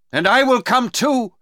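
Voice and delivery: high, loud, determined call; shout. Acted. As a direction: shout it out, pitched right up. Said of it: "shouted Duncan".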